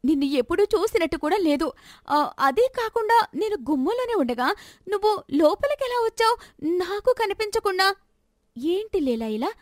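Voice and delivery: put-on high frantic young woman; exasperated daughter. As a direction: she is driven mad by the stranger.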